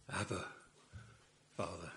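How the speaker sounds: soft, intimate call to a father; hushed tender cry. Acted. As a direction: breathe it like a child to its father.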